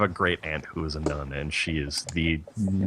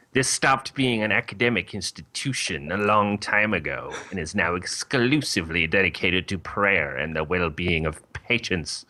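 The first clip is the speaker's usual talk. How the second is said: nun voice. Acted. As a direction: pronounce it like a stern nun in charge. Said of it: "This is the best nun voice".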